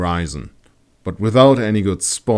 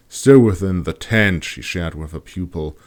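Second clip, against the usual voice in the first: usual speaking voice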